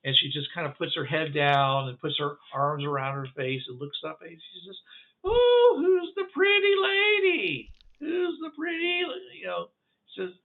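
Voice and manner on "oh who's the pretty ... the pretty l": very high, whistling creature voice; high whistling alien cooing at a lady; whistly Demixi voice. Acted. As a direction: squeak it out like a kazoo. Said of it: "the Demixi voices are whis-, are like whistle sounds".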